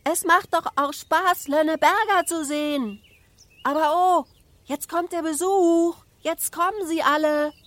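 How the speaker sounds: put-on high, loud child's voice calling down; little girl shouting. A narrator's reading. Little Ida reports the arriving guests from the flagpole, shouting. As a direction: call it all out at a high, sustained pitch. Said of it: "schrie Ida".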